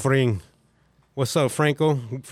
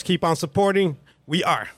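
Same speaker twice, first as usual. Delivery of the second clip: ordinary talk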